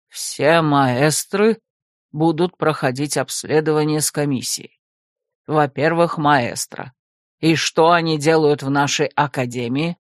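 low, deliberate reading voice; stern rector. Acted. Rector reads the protocol aloud, low and stern.

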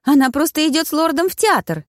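plead, high and defensive; Bridget insisting. A narrator's reading. (высокий голос, оправдывает маму)

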